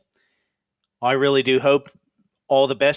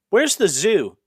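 put-on puzzled young man; 7 semitones higher, slower than usual. Young man asks the neighbor lady, higher and puzzled. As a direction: ask it like a bewildered newcomer. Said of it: "And he goes".

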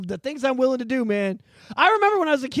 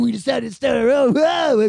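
goofy shout; 3 semitones higher, 7 dB louder than usual. Retold or imitated. Voicing Street Sharks talking.

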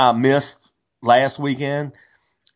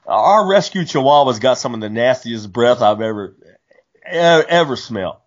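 ordinary talk

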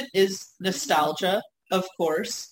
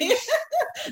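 a squealing burst of laughter; high laugh. Real, not acted. Cracking up about the Acme grocery store, high laugh. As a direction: laugh high at your own joke. Laughing at her own joke.

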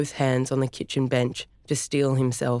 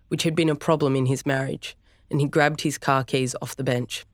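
ordinary delivery, nothing marked

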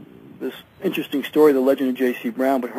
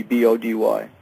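usual speaking voice

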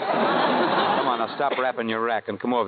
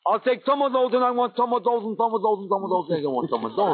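goofy chant starting high, sinking to usual pitch; silly idiot demo; 7 semitones higher than usual. Shown as a demonstration. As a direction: show the idiot voice for a learner. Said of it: "Let me have that slow".

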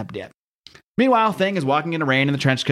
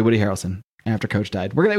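normal talking voice

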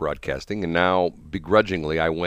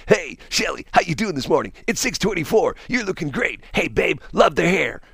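higher, smarmy morning DJ schmoozing; puker DJ voice; 11 semitones higher than usual. Retold or imitated. Imitating DJ Charlie Brown greeting Shelly, smarmy high puker voice. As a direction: ham up a slick radio jock greeting Shelly. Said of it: "Charlie talked like this".

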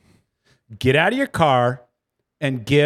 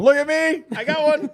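much higher, giddy show-off yelling; gleeful shout; 13 semitones higher than usual. Retold or imitated. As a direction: brag like a kid waving a trophy. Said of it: "holding that puppy out the window going".

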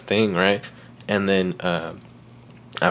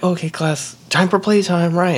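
higher-pitched playtime announcement; imitated schoolteacher, pitched up; teacher addressing class; 8 semitones higher than usual. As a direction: announce it to a roomful of kids. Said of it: "And she's like".

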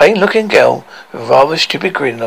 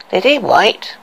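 sneering; 7 semitones higher than usual. Acted. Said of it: "asked the queen sneeringly".

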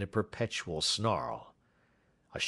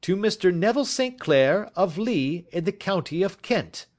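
much higher triumphant shout; 11 semitones higher, 6 dB louder than usual. A narrator's reading. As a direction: announce it with a flourish. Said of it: "he shouted".

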